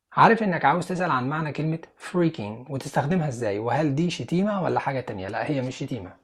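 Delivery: usual speaking voice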